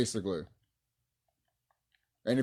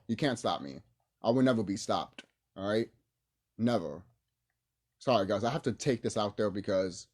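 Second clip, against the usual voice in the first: usual speaking voice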